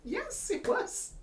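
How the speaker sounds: high, slow told-you-so; knowing told-you-so. Retold or imitated. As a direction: say it like a therapist who warned them. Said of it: "And I'm like".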